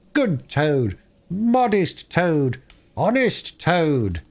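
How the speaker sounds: high, very slow mock praise; sarcastic praise. Chief Weasel jeering at Toad for laughs, slow and high. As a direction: savour each sarcastic word.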